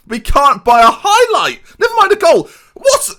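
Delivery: high, loud, nearly crying in disbelief; exasperated outburst. Real, not acted. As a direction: cry out in frustrated disbelief. Football manager vlog, team failing.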